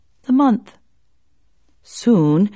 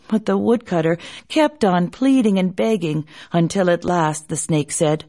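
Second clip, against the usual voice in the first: ordinary delivery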